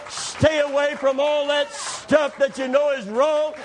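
fervent pulpit shouting; full-force preaching. Acted.

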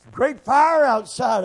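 high, loud, drawn-out cry; excited exclamation. Acted. Elijah in the cave seeing fire outside, excited cry. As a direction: call it out slowly like a sudden sighting.

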